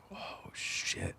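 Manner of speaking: barely whispered wonder; awestruck hush. Retold or imitated. Son walking into Minecraft world, awestruck hush. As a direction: breathe it out under the voice, amazed.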